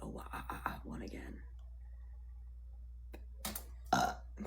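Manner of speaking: hiccuping while talking; words broken by hiccups. Real, not acted. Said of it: "I get the hiccups".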